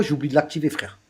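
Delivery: ordinary delivery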